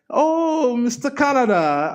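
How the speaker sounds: high, loud teasing call; mock-teasing mother's voice; teasing mom. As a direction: tease like a mom mocking her kid. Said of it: "my mom will tease me".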